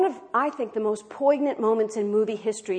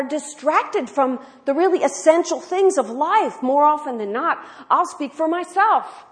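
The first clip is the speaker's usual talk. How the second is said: louder, higher sermon push; forceful preaching; 7 semitones higher, 8 dB louder than usual. Real, not acted. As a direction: preach it to the congregation.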